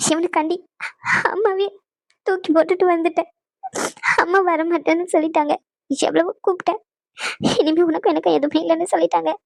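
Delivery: broken phrases between sobbing breaths; sobbing confession. Acted. Bride telling how her mother disowned her, sobbing. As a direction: pour it out haltingly through tears. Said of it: "எதுக்கு அழுதுட்டு இருக்க".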